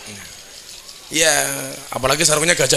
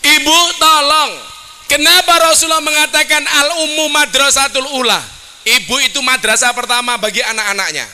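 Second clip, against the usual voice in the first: loud, higher preaching call; lantang berceramah; 9 semitones higher, 3 dB louder than usual. Acted. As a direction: preach out to the mothers. Preaching to the mothers. (ceramah lantang kepada para ibu)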